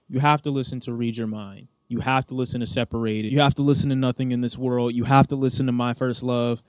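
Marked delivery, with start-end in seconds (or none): none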